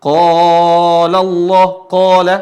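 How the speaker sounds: QALA held very long with a heavy, rounded "qaa"; thick long alif. Shown as a demonstration. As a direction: chant "Qala Allah" slowly, thick and sustained. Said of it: "Dan tebal. La, sama seperti". (qala dilantunkan tebal, sangat panjang)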